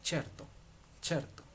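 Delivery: certo said CHER-to; Italian pronunciation. Shown as a demonstration. Teacher gives an example of C E as che, careful pronunciation. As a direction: open certo with a ch sound. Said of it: "que es che".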